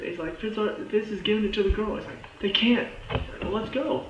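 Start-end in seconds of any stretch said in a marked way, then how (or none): 0.4-2.0 quick, high retold urgency; urgent explaining. Garrett explaining to Coulson where the vial went, acted urgency. 2.4-4.1 freaking out. Retold or imitated. Coulson alarmed that Skye gets the serum, acted panic. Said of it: "he's, like, freaking out".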